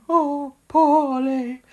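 slow, high mock Eva. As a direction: drawl it out like Eva. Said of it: "scenes of Eva going".

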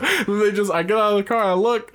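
usual speaking voice

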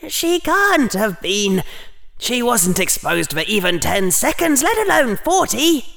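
high, desperate inner protest. A narrator's reading. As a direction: insist desperately, pitched high. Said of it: "Harry thought desperately".

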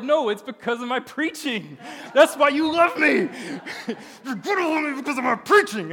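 loud, high-pitched hammed-up protest; upset. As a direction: yell it like a wounded, indignant ego. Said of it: "I was upset".

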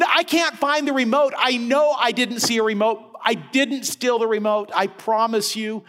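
mildly voiced worried plumber; defensive plumber. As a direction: protest your innocence as the plumber.

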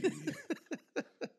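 squeaky high laugh trailing off; high falsetto laugh. Real, not acted. Laughing after DJ bit.

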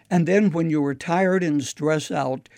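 ordinary talk

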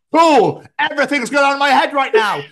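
high loud hammed-up excited shout; wired brain at bedtime, overdone; manic excitement. Host acts out his brain wide awake in bed.

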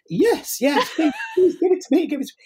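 high, eager pleading; excited begging. Retold or imitated. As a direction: beg for it, delighted. She begs for the paste.

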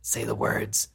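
mock pushy demand. Mocking people who demand a public statement, hushed and low. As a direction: needle them like a pushy bully, hushed. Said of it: "be like".

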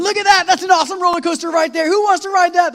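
high, loud excited yell at the coasters; thrilled youth pastor calling out to the van; excited shout. Retold or imitated. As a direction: point and shout with glee.

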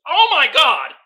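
loud, high cry of disbelief; shocked outburst. Real, not acted. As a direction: burst out at the meme. Laughing reaction to meme.

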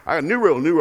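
loud, high-pitched command; barked order. Retold or imitated. Dad laying down a new house rule, barking. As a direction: bark out the announcement like a fed-up parent. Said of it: "and I barked it out".

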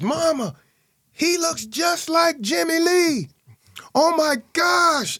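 high, slow, loud cry of joy; aunt calling to grandma, overjoyed; excited aunt. Retold or imitated. Aunt calls out to grandma in high excited joy. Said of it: "she says".

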